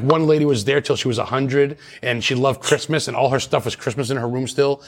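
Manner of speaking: ordinary delivery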